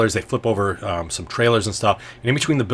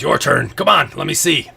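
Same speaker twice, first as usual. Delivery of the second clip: higher, louder, lightly voiced rival; goading rival's role; 4 semitones higher, 5 dB louder than usual. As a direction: egg the other player on in character. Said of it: "He says".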